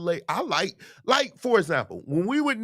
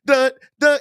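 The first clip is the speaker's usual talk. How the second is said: imitated drum hits; 8 semitones higher, 8 dB louder than usual. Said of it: "they going, dun".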